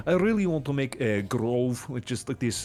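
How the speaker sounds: cartoonish Norwegian accent; lightly done comic Norwegian accent. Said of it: "another, like, Norwegian accent".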